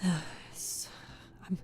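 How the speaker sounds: panting between words; gasping, winded from reading; out of breath. Real, not acted. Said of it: "I'm, like, running out of breath".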